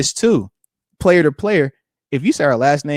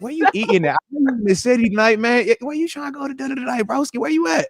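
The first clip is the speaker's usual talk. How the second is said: higher, softer, chummy voice; friendly invite to the ref; 7 semitones higher, 4 dB quieter than usual. Retold or imitated. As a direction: sweet-talk the ref, a little higher and quieter. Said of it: "So I'm like".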